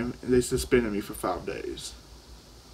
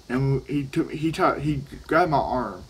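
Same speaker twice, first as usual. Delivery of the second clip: ordinary talk, nothing marked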